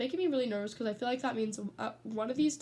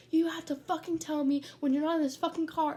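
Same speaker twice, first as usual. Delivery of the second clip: raised, higher imitation of yelling; retold angry yelling; 5 semitones higher, 4 dB louder than usual. Imitating Will scolding Cal over the car door, angry yelling. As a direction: act out an irate friend's telling-off. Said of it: "And Will's yelling".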